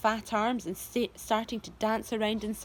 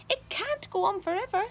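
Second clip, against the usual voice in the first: Aunt Spiker yelling; 10 semitones higher than usual. A narrator's reading. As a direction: stretch it out high and worried. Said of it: "Aunt Spiker yelled".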